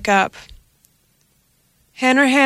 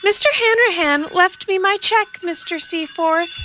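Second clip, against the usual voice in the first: higher, polite housekeeper calling from the kitchen; housekeeper's voice; 10 semitones higher than usual. A narrator's reading. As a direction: call out like a cleaning lady. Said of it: "she said".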